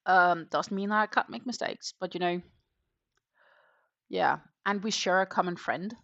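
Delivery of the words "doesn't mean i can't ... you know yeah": usual speaking voice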